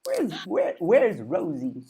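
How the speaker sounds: halting impression with odd pauses; Christopher Walken. Shown as a demonstration. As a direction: stretch and break the words oddly. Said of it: "Christopher Walken".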